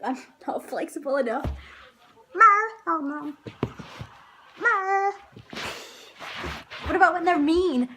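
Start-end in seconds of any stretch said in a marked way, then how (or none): 2.4-2.7 high, loud yowling meow; a sharp cat yowl; loud high cat cry. Shown as a demonstration. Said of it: "A pussycat". 4.6-5.1 a gentle kitten mew; high mewing cat; cat meow. Shown as a demonstration. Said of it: "A pussycat".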